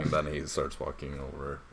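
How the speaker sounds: normal talking voice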